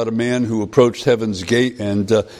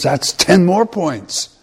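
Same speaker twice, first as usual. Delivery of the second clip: higher, slow angel verdict; 7 semitones higher, slower than usual. Retold or imitated. Angel gives the man his new score, high and slow. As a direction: announce the points slowly.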